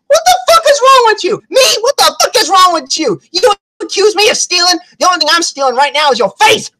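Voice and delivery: high-pitched mock-furious outburst; outraged retort. Punched man confronts the woman who accused him, outraged retort. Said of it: "And he's like".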